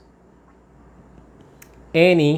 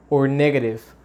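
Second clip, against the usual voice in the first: ordinary talk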